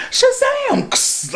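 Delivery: very high, drawn-out cry; magic word call. Retold or imitated. Billy calling out his magic word, very high and drawn out.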